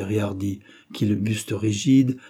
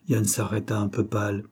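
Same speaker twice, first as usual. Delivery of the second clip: plain reading voice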